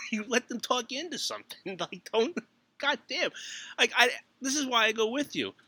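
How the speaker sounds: high, laughing mock scold; chiding wife through laughter; laughing exasperation. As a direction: crack up while telling her off. Retelling argument with wife, laughing.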